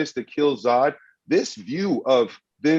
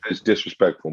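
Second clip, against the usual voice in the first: ordinary talk, nothing marked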